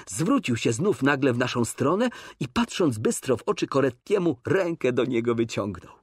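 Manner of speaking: plain reading voice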